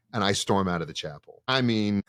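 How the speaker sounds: normal talking voice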